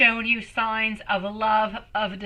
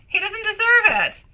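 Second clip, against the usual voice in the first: indignant; 11 semitones higher, 4 dB louder than usual. Real, not acted. Indignant about ex ignoring her.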